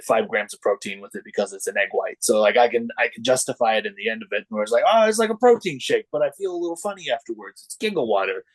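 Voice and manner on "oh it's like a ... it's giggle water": high, chirpy excuse voice; mock self-justifying voice. To the host, joking that the cocktail is a protein shake, high mock innocence. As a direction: sell it like a health drink, lightly.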